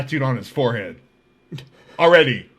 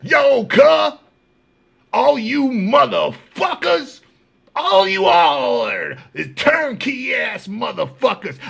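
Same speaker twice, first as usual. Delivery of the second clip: high, slow, shouted harangue, imitated; mock tier rant; 10 semitones higher, 5 dB louder, slower than usual. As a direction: berate the whole tier in a drawn-out yell. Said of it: "he would get on the tier and be like".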